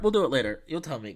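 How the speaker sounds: ordinary delivery, nothing marked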